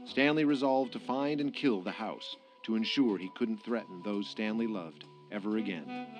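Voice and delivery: ordinary talk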